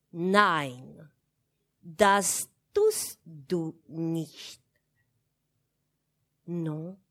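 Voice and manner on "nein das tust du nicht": Nein, das tust du nicht, each syllable pressed very slowly and firmly; every syllable pressed. Acted. Governess forbidding the child, each syllable of Nein, das tust du nicht pressed slowly. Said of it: "en appuyant très fort sur chaque syllabe".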